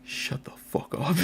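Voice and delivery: urgent whispered shush; hissed hush. Acted. Raider silencing the chatterbox, hissed whisper. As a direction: whisper it fiercely to shut him up. Raider hushes his companion.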